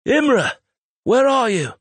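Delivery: high, loud desperate scream; panicked full-voice call. A narrator's reading. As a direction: scream for someone lost in a storm. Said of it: "screaming as loud as he could".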